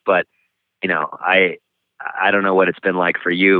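ordinary talk, nothing marked

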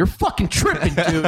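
high, laughing mock outrage at the ref; retelling a shout at the ref, half laughing; mock angry retort. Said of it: "And I said".

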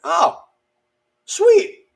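high, loud burst of mock elation; delighted relief. Jumper realizing the hard part is over, high elated relief. Retold relief once chute opens.

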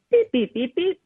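loud clipped beeps; phone beeping. Acted. Narrator imitating Monster's phone call to Clown, loud beeps. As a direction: sound like a phone dialing.

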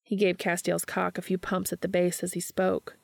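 ordinary delivery

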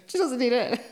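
said through a high laugh; giggling the words out. Real, not acted. Laughing at the joke.

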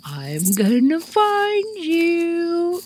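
high, slow sing-song I'm gonna find you; a lightly hammed-up seeker calling; imitating Sharon. Sharon playfully calling while searching the field. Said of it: "She's walking around the mustard field".

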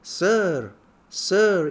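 calling out high from across the carriage; distant shout. Retold or imitated. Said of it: "somebody shouting".

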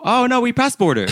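very high, carefree brush-off; breezy driver. Retold or imitated. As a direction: toss it off like it's no big deal. Driver breezily dismisses the border.

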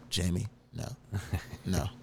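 low, soft flat refusal; quiet mock disbelief; mock deadpan. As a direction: shut her down softly and dry. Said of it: "And I was like".

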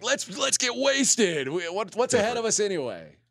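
high, slow, hammed-up party-boy voice; mock partying athlete. Host mocks athletes celebrating a play-in win, high and slow. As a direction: drag it out like a player ready to get wasted.

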